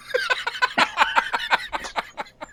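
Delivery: real high laugh at the punchline. Real, not acted. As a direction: burst of high-pitched laughter at own joke. After punchline.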